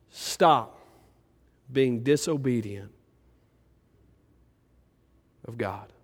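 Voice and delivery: dropping to a soft hush; fading quiet plea. Retold or imitated. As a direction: let the command die away gently. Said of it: "God may be just sitting there going".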